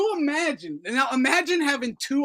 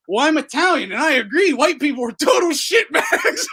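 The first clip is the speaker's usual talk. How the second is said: mock spineless voice; 3 semitones higher, 7 dB louder than usual. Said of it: "be like".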